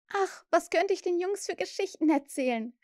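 put-on high, eager girlish exclamation; calling out. A narrator's reading. Wendy tempts Peter with stories, calling out. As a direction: exclaim with the pitch up. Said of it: "rief sie".

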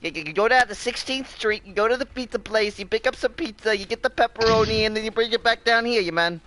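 hint of a New York Italian mobster; Italian accent. Lightly voiced Italian mobster giving his crew orders, mock accent. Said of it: "an Italian accent anymore".

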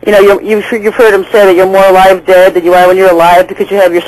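ordinary talk

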